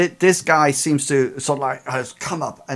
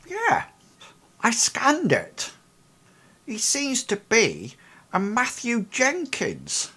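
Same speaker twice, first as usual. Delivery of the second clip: much higher woman's voice; Ida explaining; 10 semitones higher than usual. Acted. Ida telling the investigators who the man is, a much higher matter-of-fact woman's voice. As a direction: answer matter-of-factly as the woman. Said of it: "Well, she goes".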